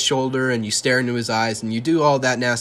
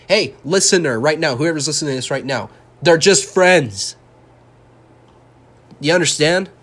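sarcastic; 4 semitones higher, 6 dB louder than usual. Pretend. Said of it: "I'm just being all sarcastic now".

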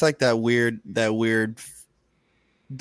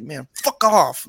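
louder, drawn-out retold dismissal; irritated brush-off; 3 semitones higher, 5 dB louder, slower than usual. Upset dad to people checking on him, irritated brush-off. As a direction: wave them away with a mock yell.

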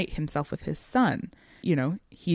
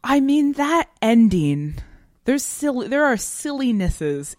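higher and louder, words pushed out; emphatic outburst; 8 semitones higher, 7 dB louder than usual. Real, not acted. Podcaster bursting out over the sad ending, emphatic.

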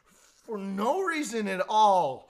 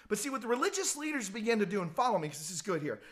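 ordinary talk, nothing marked

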